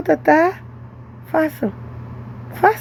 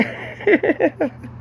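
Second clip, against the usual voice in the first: short laugh; 5 semitones higher, 4 dB quieter than usual. Real, not acted. Laughs while telling the story.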